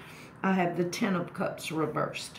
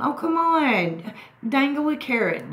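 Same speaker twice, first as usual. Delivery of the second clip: excited teasing call; 9 semitones higher, 5 dB louder than usual. Real, not acted. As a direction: coax him out with a big playful call. Teasing a silent viewer to speak.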